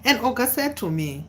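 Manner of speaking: normal talking voice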